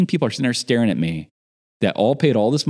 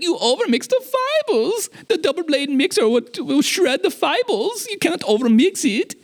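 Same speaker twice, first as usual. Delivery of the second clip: much higher, amused German tech support voice; imitating German tech guy. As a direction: play the giggling German engineer. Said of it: "He's still German".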